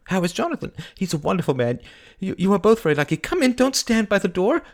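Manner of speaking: high, gushing chatter with little laughs; giddy. Acted. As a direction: ramble giddily from topic to topic. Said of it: "she cried giddily".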